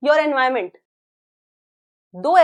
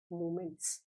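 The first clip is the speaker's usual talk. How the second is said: whisper; 8 semitones lower, 19 dB quieter than usual. Acted. Teacher's dramatic reveal.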